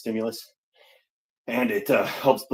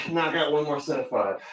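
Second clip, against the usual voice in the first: normal talking voice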